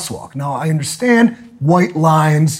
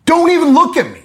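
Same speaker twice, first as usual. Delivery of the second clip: high, indignant complaint; 12 semitones higher, 3 dB louder, faster than usual. Retold or imitated. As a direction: sound insulted at being ignored. Outraged at ignoring staff.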